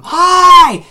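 put-on huge high-pitched kid yell; yelled greeting. Imitating Leander greeting people on a ride, yelling. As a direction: holler it at a passing stranger. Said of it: "he yells at them".

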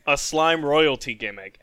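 ordinary delivery

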